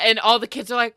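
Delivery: usual speaking voice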